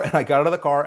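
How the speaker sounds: usual speaking voice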